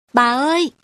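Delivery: bursting in, shouting bà ơi; calling out. Acted. Ngân bursts in calling out to her grandmother, loud high call.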